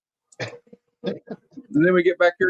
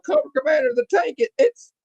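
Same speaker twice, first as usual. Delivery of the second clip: breathless, much higher alarmed gasping; panicked warning; 13 semitones higher than usual. Retold or imitated. Aide panics as the tank comes straight at Cobra, high breathless alarm.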